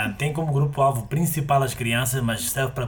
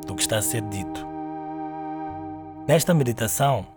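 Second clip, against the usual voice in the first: guided meditation. Acted. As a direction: guide the listener gently into stillness.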